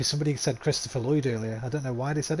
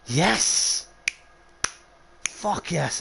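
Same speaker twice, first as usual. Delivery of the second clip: loud, high burst of triumph; real joy at the right guess; excited shout; 6 semitones higher, 9 dB louder, much slower than usual. Real, not acted. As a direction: yell it like you just won. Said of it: "Nice detective work".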